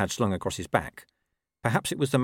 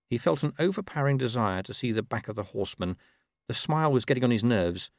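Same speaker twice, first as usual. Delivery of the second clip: ordinary delivery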